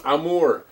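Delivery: "Amor" said French-style, stress on the second syllable; mispronounced armor as Amor. Retold or imitated. Player mimics his friend's slip, French-sounding "Amor". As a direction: say "Amor" with no first r, drawn on "-mor". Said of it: "you weren't saying it like that".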